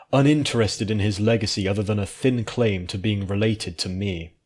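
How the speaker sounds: usual speaking voice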